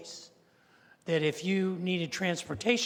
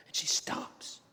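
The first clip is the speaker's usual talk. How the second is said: whispered dramatic pause; suspenseful whisper; 4 dB quieter, slower than usual. Real, not acted. Suspense as hostess checks list.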